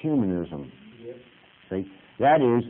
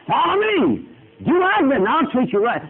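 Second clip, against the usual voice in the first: much higher and louder, a hammed-up devil calling out; Satan luring; 13 semitones higher, 6 dB louder than usual. Preacher acting Satan luring a struggling believer, loud mock devil. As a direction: bellow it up high like a carnival barker. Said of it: "Satan gets in and says".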